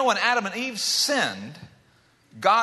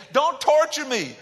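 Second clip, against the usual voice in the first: begging; 8 semitones higher, 4 dB louder, slower than usual. Retold or imitated. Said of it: "he starts begging".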